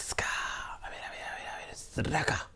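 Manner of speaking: soft whisper while rummaging; hushed searching mutter. Real, not acted. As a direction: whisper it to yourself. Searching for the coin.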